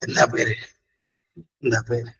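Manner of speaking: mock worn-out voice; roughened voice now. Shown as a demonstration. Teacher mimics asking someone's name nowadays, roughened voice. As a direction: show how a spoilt voice asks it. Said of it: "ഇപ്പൊ എന്താണ് പേര് ചോദിച്ചാ".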